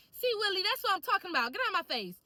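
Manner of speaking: coming on strong. Retold or imitated. Telling off Willie who came up too close, strong. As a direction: scold him firmly, short of truly mean. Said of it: "I had to come a little strong like that".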